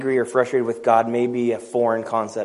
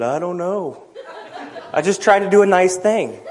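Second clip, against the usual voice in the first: laughing; 6 semitones higher, 3 dB louder than usual. Retold or imitated. Retelling awkward moment with wife.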